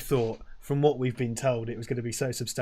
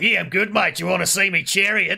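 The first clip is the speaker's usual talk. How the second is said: high, loud, matey Australian bloke; casual Australian; 7 semitones higher, 9 dB louder than usual. Retold or imitated. Retelling the TV piece, Russell Crowe answering the reporter in a loud matey Australian voice. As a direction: offer it like a relaxed Australian showing off. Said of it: "And he's like".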